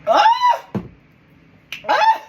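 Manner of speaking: high startled cry; shocked gasp. Real, not acted. Streamer reacts to a disturbing clip, startled cry.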